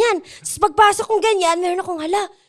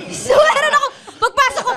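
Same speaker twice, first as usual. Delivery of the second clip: laughing; 10 semitones higher, 5 dB louder than usual. Real, not acted. Giggling while starting the story.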